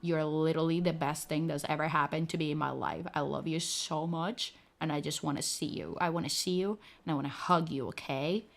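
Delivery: soft, low, tender pleading; puppy-eyes plea. Pretend. Vtuber pleading softly to her Bon Bon with puppy eyes.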